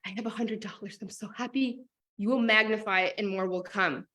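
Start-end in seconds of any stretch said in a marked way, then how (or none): 0.0-1.8 hushed, breathy awe at the money; soft whispered thanks; whispered gratitude. Shown as a demonstration. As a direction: whisper it like a precious secret. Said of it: "hold it and be like".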